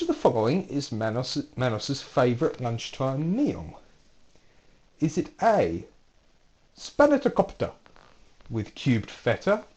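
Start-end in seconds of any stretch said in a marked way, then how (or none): 5.0-9.6 a light mock Greek accent on the food names; lightly put-on Greek accent for a quiz answer. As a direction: say the dish like a Greek waiter, hammed-up a little. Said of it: "Don't know why I have to do the accent".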